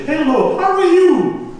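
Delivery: very high, sing-out street greeting; high-pitched English greeting. Acted. Performer greeting strangers in the street, high-pitched English call. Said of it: "Parfois, je parle anglais. Dans la rue".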